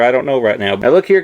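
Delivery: ordinary talk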